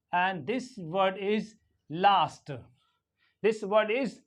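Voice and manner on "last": LAST as 'lahst', long open vowel; British long A. Shown as a demonstration. Teacher modelling British English, long-ah LAST. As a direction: say last with the A of father. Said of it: "it is longer".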